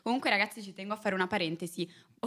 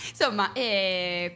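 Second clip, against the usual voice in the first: slow words through a laugh; 2 semitones higher, 5 dB louder, much slower than usual. Real, not acted. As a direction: still laughing as she talks. Laughing after her own joke.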